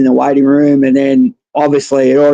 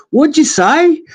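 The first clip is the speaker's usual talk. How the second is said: retold challenge; 11 semitones higher, slower than usual. Patient confronting the doctor he just hit, retold high-pitched challenge.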